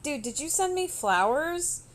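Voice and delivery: re-enacted baffled demand; retold puzzled question. Retelling her puzzled questioning of coworker.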